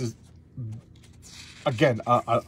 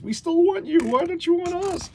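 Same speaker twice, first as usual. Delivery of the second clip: put-on much higher, pleading girly voices; pleading demon women; 5 dB louder than usual. Demons begging the band to stay, high pleading voices. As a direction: whine it like spurned temptresses. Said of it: "So now he's like".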